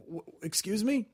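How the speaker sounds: soft, stumbling mock-meek question; mock timid. Non-confrontational comic answering a heckler, mildly timid. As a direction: falter softly, avoiding any confrontation. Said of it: "not, like, a confrontational dude".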